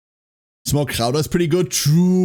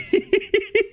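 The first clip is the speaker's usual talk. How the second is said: high-pitched giggle. Real, not acted. Laughing after joke.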